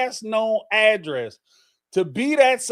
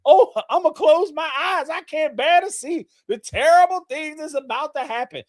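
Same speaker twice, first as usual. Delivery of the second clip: high, loud shrieking panic; hammed-up terrified shriek; mock horror-movie fright; 10 semitones higher, 6 dB louder than usual. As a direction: squeal like a scared moviegoer. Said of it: "jump out and be like".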